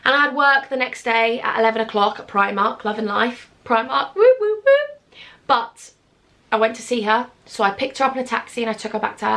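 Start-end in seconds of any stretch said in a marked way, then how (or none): 4.2-5.0 high, loud playful "woo"s; mock cheering woos. Real, not acted. Cheering her job at Primark, high playful whooping.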